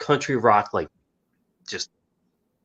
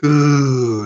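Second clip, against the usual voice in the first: [name] ooh; 3 semitones higher, 4 dB louder than usual. Retold or imitated. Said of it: "was it [name]".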